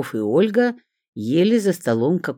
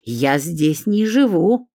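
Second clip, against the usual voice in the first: higher, slow, offended protest; offended hiss; 5 semitones higher, 4 dB louder, slower than usual. A narrator's reading. Olga denying she lives here, offended. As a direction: draw it out as if insulted. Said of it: "оскорблённо зашипела Ольга".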